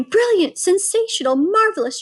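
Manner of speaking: high, loud cheering cries; excited shouting crowd. A narrator's reading. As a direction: cheer her on wildly.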